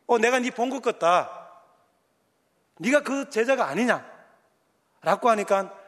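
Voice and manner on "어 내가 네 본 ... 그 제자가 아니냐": put-on higher, louder accusing servant girl. Servant girl accusing Peter: higher, louder. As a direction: point the finger at Peter.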